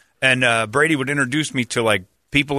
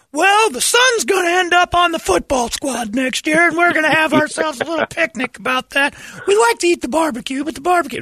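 hammed-up small-town chatterer, pitched way up; oversharing local. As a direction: prattle on like an overfriendly football-town neighbour. Parody of oversharing Columbus local.

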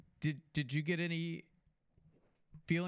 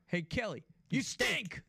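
mock heckler calling out, higher on the punchline; Bronner; 5 semitones higher, slower than usual. Host imitating a colleague heckling the coach, mock jeer. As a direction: call it out as a teasing jeer. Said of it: "give him a little Bronner".

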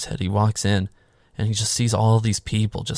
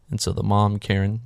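usual speaking voice

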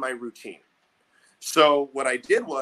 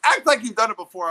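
higher, louder stern coach; coach's scold; 7 semitones higher, 7 dB louder, faster than usual. Retold or imitated. Coach scolding a fired-up player, louder stern quote. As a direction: bark it like a coach. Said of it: "my coach goes".

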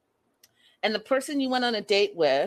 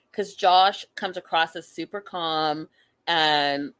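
ordinary talk, nothing marked

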